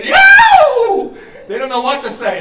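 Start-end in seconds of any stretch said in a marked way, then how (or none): 0.0-1.2 stretched high whoop, very loud; scream of joy. Acted. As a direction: shriek like a lottery winner. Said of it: "that's a scream of joy".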